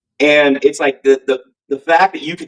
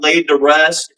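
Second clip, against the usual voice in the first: usual speaking voice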